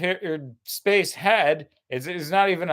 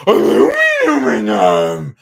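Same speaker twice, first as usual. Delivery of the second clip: dumb American; 8 semitones higher, 11 dB louder than usual. Retold or imitated. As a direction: stretch "Aluminum" like a proud yokel. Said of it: "Americans who go".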